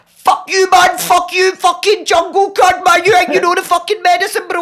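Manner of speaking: high-pitched ranting abuse; acted-out manic tirade; screaming. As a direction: hurl it at him in a frantic squeal. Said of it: "I'm screaming it at him".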